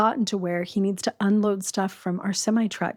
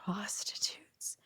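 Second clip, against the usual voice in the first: whispered, slow; whispered reveal of a shameful word; 14 dB quieter, much slower than usual. Real, not acted. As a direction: breathe the word out in a hush.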